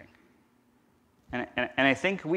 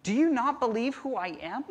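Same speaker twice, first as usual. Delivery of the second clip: higher, pained question as Jesus; pleading rebuke; 11 semitones higher than usual. Retold or imitated. Jesus challenging his grieving followers, higher and pained. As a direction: ask it hurt and disappointed. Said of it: "told her that I have the ability".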